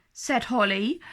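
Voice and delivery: usual speaking voice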